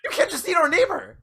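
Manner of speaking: high, incredulous mock outrage; horrified disbelief. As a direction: scold in acted horror, pitch shooting up. Horrified roommate confronting zombie friend.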